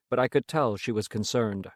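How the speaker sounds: ordinary delivery, nothing marked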